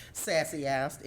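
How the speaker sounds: plain reading voice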